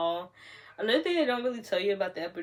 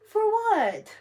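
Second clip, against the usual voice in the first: much higher, louder startled question; alarmed disbelief; 13 semitones higher, 7 dB louder than usual. Real, not acted. New mother asking nurses why they page doctor, alarmed and high.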